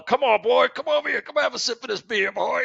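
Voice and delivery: high-pitched, boozy coaxing; toasted bar drinkers. Retold or imitated. Drunk bar regulars offer a boy a sip, coaxing high. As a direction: egg the boy on like a tipsy regular. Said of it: "These guys are toasted".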